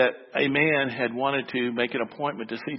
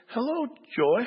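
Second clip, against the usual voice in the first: put-on high, bright small-boy greeting; little boy voice; 10 semitones higher than usual. Pastor's little son greeting the secretary, high child voice. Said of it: "heard this little voice again".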